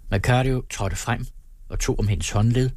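ordinary delivery

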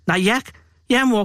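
high-pitched, lightly acted crying; faltering tearful answer to mum. A narrator's reading. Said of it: "græd storebror". (let grædende storebror)

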